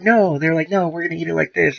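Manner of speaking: mocking the girls. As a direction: ham up their stubborn refusal.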